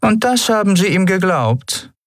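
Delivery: incredulous. Acted. Captain doubting Sephiron's trust in Endriel, incredulous. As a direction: sneer the question.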